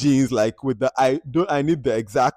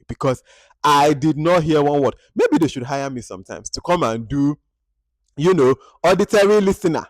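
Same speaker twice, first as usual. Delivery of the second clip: ordinary talk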